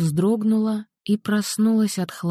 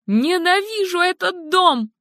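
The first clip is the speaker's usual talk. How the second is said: even higher, rawer repeat; escalated scream; 12 semitones higher than usual. Acted. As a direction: push the repeat higher and harder.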